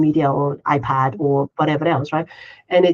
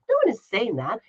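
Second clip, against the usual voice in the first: normal talking voice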